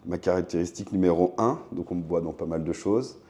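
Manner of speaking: ordinary talk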